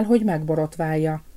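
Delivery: ordinary delivery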